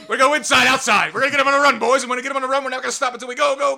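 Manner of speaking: rally the team, voice way up and loud; fired-up locker room speech. Retold or imitated. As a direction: shout "We're gonna go inside" high and loud like a coach. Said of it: "is that the speech from Rudy".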